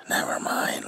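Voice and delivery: ordinary delivery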